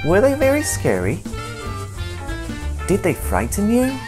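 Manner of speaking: ordinary delivery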